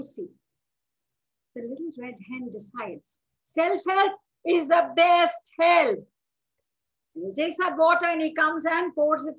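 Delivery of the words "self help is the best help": loud, high, slow proclamation; ringing, measured announcement of resolve; emphatic declaration. Acted. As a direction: proclaim it like a motto. Hen's proud resolve declared.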